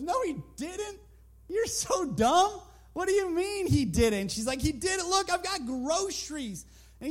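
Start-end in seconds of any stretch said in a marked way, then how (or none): 0.0-4.3 high mocking retort; lightly voiced mocking neighbor; scoffing neighbor. 4.6-6.6 insistent woman. Acted. Woman answers neighbor.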